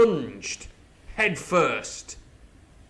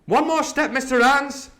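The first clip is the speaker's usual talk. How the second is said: high, loud threat down the barrel; threatening shout; 12 semitones higher, 6 dB louder than usual. Acted. Jim threatening the coxswain from the mast, loud and high. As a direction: bark the warning up the rigging.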